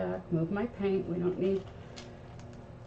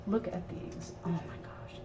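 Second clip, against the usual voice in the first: hushed delight; 10 dB quieter than usual. Real, not acted. Gushing over the flower pods.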